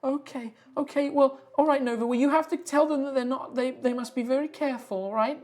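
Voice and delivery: high, earnest and a little anxious; high voice making Nova promise; wood spirit. Acted.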